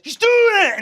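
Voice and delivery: high, loud accusing yell; shout. Acted. Dane yells an accusation in a rage, loud. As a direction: point and yell it. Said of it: "Dane says".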